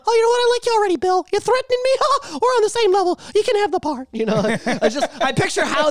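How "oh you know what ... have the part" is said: very high squeaky cartoon mouse delight; Mickey Mouse voice. Mickey Mouse hiring Bill Burr, squeaky delight.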